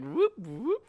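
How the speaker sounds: high whooping helicopter noise; mock helicopter. Helicopter landing and leaving, high whooping imitation. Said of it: "helicopter is just like".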